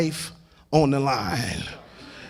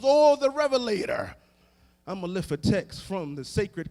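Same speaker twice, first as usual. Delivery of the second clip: preaching climb; 4 semitones higher, 4 dB quieter, faster than usual. Acted. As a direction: build the list up to a sung peak.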